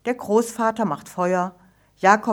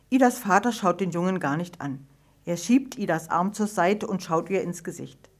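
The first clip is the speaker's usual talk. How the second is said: ordinary delivery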